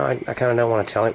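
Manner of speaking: normal talking voice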